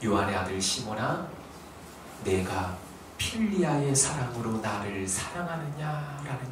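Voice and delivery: soft, low, gentle asking; tender question. Acted. The Lord gently asking Peter, soft and tender. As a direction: ask it gently, as love stooping down.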